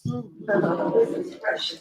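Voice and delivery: very slow, heartfelt repeat; slow emphatic. Real, not acted. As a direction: draw each word out slowly.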